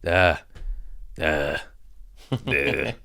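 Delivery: blank, wordless comic droning; mock dead inside. Contrasting jaded self with the nervous beginner, mock numbness. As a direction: let out numb, separated grunts. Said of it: "I'm dead inside now".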